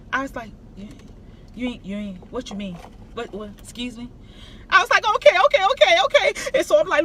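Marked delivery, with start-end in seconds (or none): 0.8-4.1 much softer, breathless disbelief; stunned hush. Retold or imitated. Stunned by agent's offer. 5.2-6.3 much higher, louder excited okays; relieved rapid okays. Retold or imitated. Relieved agreement to agent.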